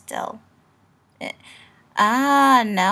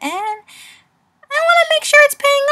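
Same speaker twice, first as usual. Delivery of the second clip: rising squeaky voice; 13 dB louder than usual. Acted. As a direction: pitch climbs high and loud. Rising to a high, loud voice.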